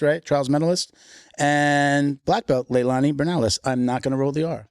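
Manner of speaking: ordinary talk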